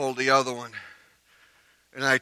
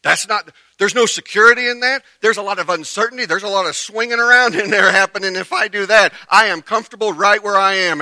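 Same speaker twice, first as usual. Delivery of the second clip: high, loud insistent objection; acted loud protest; 8 semitones higher, 12 dB louder than usual. Preacher as stuck child refusing to move, loud protest. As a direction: argue back hard at the yelling kids.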